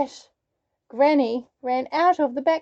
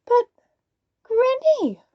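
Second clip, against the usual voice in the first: usual speaking voice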